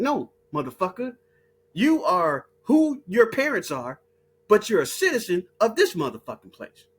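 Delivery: usual speaking voice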